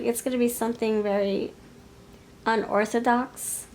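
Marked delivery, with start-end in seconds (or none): none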